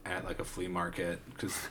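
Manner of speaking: low, soft, hesitant mumble; mock sheepish explanation. Katana owner explaining himself to police, subdued and low. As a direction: explain it quietly, as the imitated suspect.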